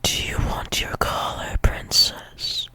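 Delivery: hushed, coaxing whisper. Acted. Partner asks princess about the collar, whispered. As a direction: whisper it intimately, as an invitation. Teasing partner in intimate roleplay.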